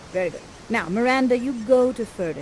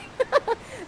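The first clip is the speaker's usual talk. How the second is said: much higher emotional giggle; teary high laugh; 3 dB quieter than usual. Real, not acted. Narrator overcome by the romance, laughing much higher. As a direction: laugh through almost crying. After "I'm gonna cry.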